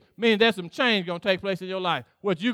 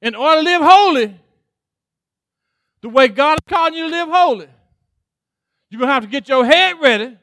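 louder preaching, driving on; building preaching; 2 semitones higher, 5 dB louder than usual. Acted. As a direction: push it home to the congregation. Preaching at full force.

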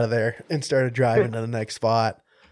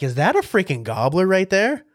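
high-pitched incredulous question; retold double take at a sudden sighting; amazed disbelief; 7 semitones higher, 3 dB louder than usual. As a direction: ask it higher, astonished and excited. Retelling a surprise turkey sighting.

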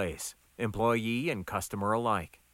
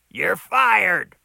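loud shout through a doorway; 8 semitones higher, 10 dB louder than usual. A narrator's reading. Boss shouts at cook through door, loud yell. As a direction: yell it high and hard. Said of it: "Max shouted through the open door".

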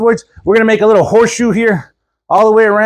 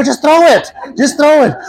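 urgent advice; 7 semitones higher than usual. Retold or imitated. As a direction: blurt it out, half laughing. Telling panicked friend what to do.

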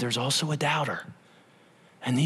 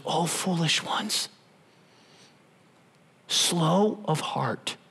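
gentle rebuke; 4 dB quieter, much slower than usual. Acted. Jesus gently rebukes the disciples.

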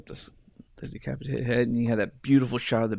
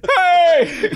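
shrill high shout; high yell; 7 dB louder than usual. Retold or imitated. Character yelling in movie.

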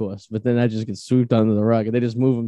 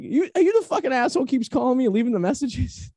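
imitating angry Ron; 12 semitones higher than usual. Annoyed porn star on prank call.

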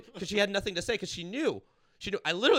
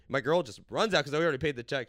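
usual speaking voice

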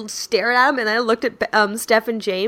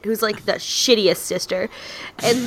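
usual speaking voice

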